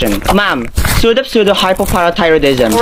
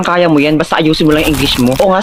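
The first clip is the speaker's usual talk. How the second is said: ordinary delivery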